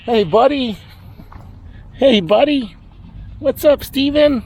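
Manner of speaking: high sing-song pet greeting. Real, not acted. Farmer greeting his animals in high pet voice. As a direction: coo at the animals like babies.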